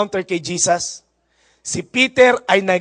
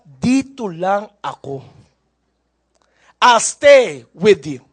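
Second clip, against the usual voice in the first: dramatic promise; much slower than usual. Acted. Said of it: "ito pa ang drama".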